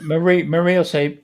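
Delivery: normal talking voice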